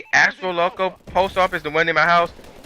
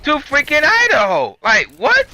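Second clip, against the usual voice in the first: incredulous outrage; 8 semitones higher, slower than usual. Retold or imitated. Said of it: "I'm like".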